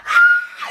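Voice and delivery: very high, loud mock shriek; imitated scream. Contestant dangling from the wall, mock screaming. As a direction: squeal out a comic panicked scream.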